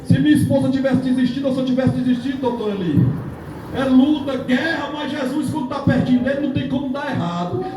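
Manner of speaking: high, urgent pulpit delivery; fervent sermon climax; impassioned preaching. Real, not acted. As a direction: preach it with rising fervour, pitched high. Preaching at full force.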